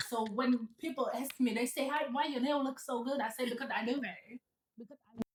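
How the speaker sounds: light Vietnamese accent. Shown as a demonstration.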